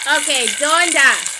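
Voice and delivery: loud, slow, commanding call; stern address. Real, not acted. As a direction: summon her to answer, firmly. Confronting the spirit directly.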